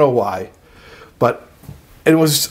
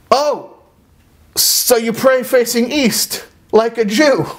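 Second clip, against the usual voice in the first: blurted quip; 8 semitones higher, slower than usual. Retold or imitated. His blurted remark retold.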